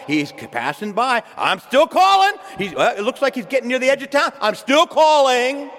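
loud high insistent call; calling out loudly. Acted. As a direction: shout after someone passing by. Acting the blind man calling.

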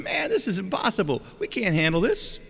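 high-pitched acted exasperation; exasperated. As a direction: protest as an overwhelmed disciple. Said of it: "So Philip is exasperated".

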